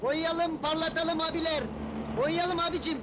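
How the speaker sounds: rhythmic street call; shoeshine hawker's cry. Acted. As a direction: call out evenly to passing customers. Shoeshine seller hawking for customers. (boyacı gibi ritmik seslenme)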